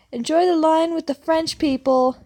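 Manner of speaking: loud, high parting jab; shouted taunt. Retold or imitated. Narrator shouting back at the pushy woman while walking off. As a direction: call it back over your shoulder, gloating. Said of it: "I shouted back".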